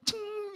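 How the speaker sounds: vanishing ping sound effect; a very high, sustained ting; high ringing "cing". Shown as a demonstration. Same vanishing sound again. (bunyi "cing" melengking tinggi)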